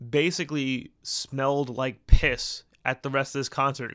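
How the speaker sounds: ordinary delivery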